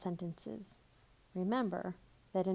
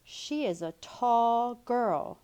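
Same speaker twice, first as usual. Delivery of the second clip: louder, slow and emphatic; excited exclamation; 4 semitones higher, 7 dB louder, much slower than usual. Shown as a demonstration. Teacher showing exclamation point reading, excited. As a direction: read it with excitement. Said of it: "or an exclamation point".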